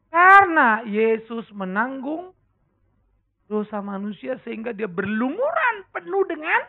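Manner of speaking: slow, loud, rising preacher's stress; full-force preaching climax; building emphasis. Real, not acted. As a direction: press each word home, slowly.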